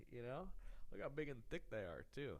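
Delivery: ordinary talk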